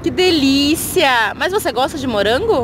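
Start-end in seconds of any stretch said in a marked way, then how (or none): 0.0-1.3 delighted. Real, not acted. Mother's delighted reply to child.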